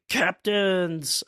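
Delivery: high-pitched mock outcry; giant pirates' cry. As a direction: cry out high to fallen leaders. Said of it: "Jarl are like".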